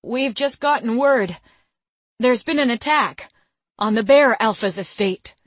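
urgent frightened messenger; quavering. Acted. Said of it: "A quavering voice".

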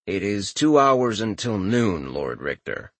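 slow, low deadpan servant; drawn-out dry servant reply. Acted. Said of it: "in his deadpan voice".

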